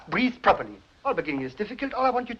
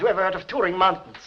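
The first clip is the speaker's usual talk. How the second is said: ordinary talk, nothing marked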